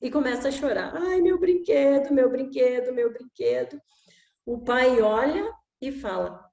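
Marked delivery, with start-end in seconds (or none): 1.0-3.8 put-on crying child. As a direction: whimper like a little boy over his toy. Child crying over broken toy.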